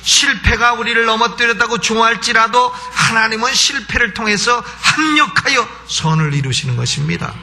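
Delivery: high, insistent pulpit voice; forceful preaching. Acted. Preacher urging the congregation not to fear the devil, forceful preaching. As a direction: drive each clause up to a blessing.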